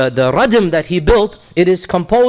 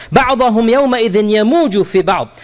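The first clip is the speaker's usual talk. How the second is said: lifted chanted tajweed recitation; Quran recitation; 3 semitones higher, much faster than usual. Acted. Teacher reciting the swarming verse to his class, in recitation.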